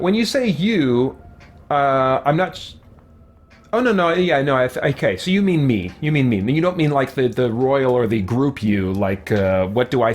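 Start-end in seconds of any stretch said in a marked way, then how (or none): none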